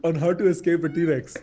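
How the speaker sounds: talking through real laughter, loud and slowed; laughing. Real, not acted. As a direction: crack up while getting the joke out. Cracking up at shared joke.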